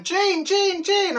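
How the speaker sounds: put-on high excited toddler chant. Toddler chanting at the trains, high and loud. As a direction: squeal it like a wee girl seeing trains. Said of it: "she's still going".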